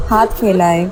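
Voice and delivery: ordinary delivery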